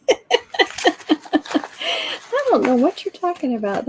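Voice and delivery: hammed-up innocent denial; mock denial. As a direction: protest innocence with emphasis. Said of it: "she's using that mom voice".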